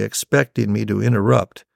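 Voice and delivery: ordinary delivery, nothing marked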